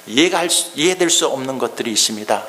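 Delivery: ordinary delivery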